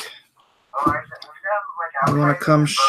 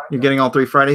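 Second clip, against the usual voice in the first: usual speaking voice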